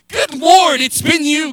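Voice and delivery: loud, high-pitched cry of realization; preacher's impassioned acted prayer; fervent exclamation. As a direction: burst out to God in gratitude. Acted outburst of gratitude to God.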